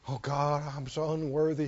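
low, soft, feigned-humble prayer; mock unworthiness. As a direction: pray in mock self-pity. Mocking falsely humble prayer.

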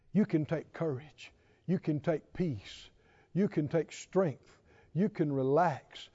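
low, soft, tender assurance; soft reassurance. Real, not acted. Preacher reassuring believers they can relax, low and soft. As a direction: ease them gently into peace.

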